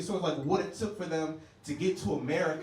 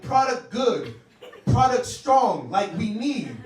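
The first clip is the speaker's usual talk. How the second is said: broken English; 3 semitones higher, 7 dB louder, slower than usual. Retold or imitated. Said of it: "giving them a presentation".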